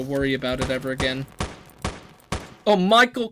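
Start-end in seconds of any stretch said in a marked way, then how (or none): 2.6-3.3 Michael Caine impression. Gamer remembering the actor, mock Caine voice. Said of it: "pardon my horrible, horrible acc-, uh, impersonation of him".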